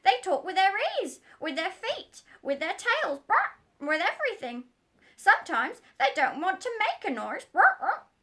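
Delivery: high, loud squawking parrot. Acted. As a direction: squawk it like a know-all parrot.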